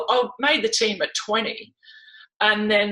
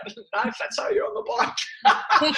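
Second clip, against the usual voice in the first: teasing dad; 13 semitones higher than usual. Retold or imitated. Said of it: "He went".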